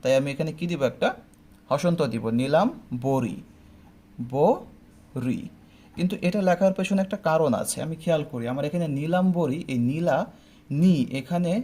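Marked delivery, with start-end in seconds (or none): 2.4-5.4 নীলাম বরি said as two parts, stop after নীলাম; teacher sounds out নীলাম বরি; syllable-split pronunciation. Shown as a demonstration. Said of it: "তো উচ্চারণটা কেমন হবে?".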